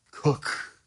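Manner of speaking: breathy whispered "Cook"; whispered aside. Shown as a demonstration. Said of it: "how do you do parentheses and exclamation point together".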